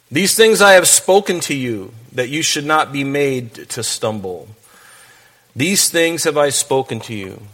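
measured, solemn Bible reading; scripture reading. Acted. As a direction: read the verses with weight, slowing on key lines. Reads scripture aloud in sermon.

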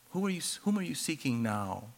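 hushed, gentle repeat of the question; second asking, hushed and gentle; soft second asking. Retold or imitated. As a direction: drop almost to a hush, tender. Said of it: "he goes, um".

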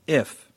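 If leaned on, set apart; stress on if. Shown as a demonstration. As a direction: weigh the word If before going on. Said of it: "I'm gonna emphasize certain words".

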